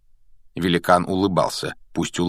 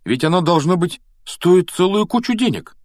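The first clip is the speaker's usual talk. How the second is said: put-on high, louder boyish voice; impressed boy; 10 semitones higher, 4 dB louder than usual. A narrator's reading. Ron examines the egg, high and impressed. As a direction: marvel in a high voice. Ron marvels at egg's value.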